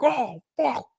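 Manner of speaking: high mock exasperation; hammed-up pissed-off groan; pissed-off customer. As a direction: imitate an overwrought customer. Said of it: "why are you so pissed".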